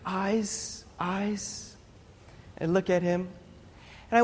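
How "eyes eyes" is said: soft, drawn-out call for attention; hushed, patient beckoning, as God; gentle summons. Acted. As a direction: quietly call someone's gaze back to you. God gently calling him to look.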